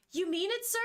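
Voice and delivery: high-pitched mock-eager question; sarcastic. Denji answering the man who offered him money, sarcastic. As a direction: feign eagerness with a sarcastic lift on sir. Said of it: "He's being sarcastic".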